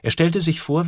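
plain reading voice

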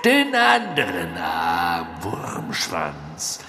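slow, sharp, menacing order; cold dark lord. Acted. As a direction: bark it, then drop cold.

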